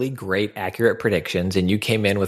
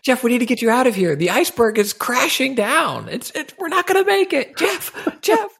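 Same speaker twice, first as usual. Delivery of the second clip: panicked. Pretend. Acted panicked rescue call.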